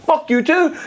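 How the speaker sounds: high, comic comeback on the edge of laughter; mock defiant retort. Retelling his reply to the man, mock defiance.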